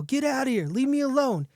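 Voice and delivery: high-pitched storyteller's yell. A narrator's reading. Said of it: "continuing to yell".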